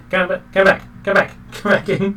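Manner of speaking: high-pitched calling voice; high, repeated mock pleas to return; calling after someone. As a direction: call after a runaway in a high character voice. Calling after runaway cat character.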